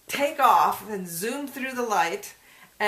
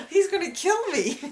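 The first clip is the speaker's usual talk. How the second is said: panicked cry; 10 semitones higher, 5 dB louder than usual. Retold or imitated. Panicked car chase quote.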